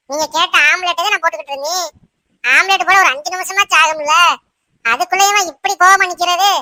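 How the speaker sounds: high-pitched coaxing of an angry husband; placating wife. Acted.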